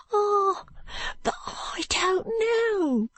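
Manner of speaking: high, slow, whispered and tearful; a frightened boy whispering a denial; fearful. Acted. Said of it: "shaking his head fearfully".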